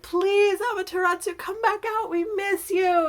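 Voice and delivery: high, loud storybook begging; pleading. Acted. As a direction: beg her earnestly, drawing out the last words. People beg goddess to come out.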